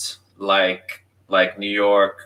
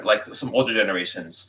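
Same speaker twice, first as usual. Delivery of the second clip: ordinary talk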